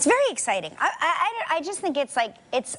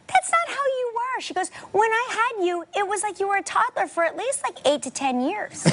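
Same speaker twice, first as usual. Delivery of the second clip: a higher, lightly voiced mom quote; imitating mom; 8 semitones higher than usual. Daughter quoting her mom about her childhood size, lightly imitated higher voice. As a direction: mimic mom marveling at tiny baby. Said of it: "And my mom was like".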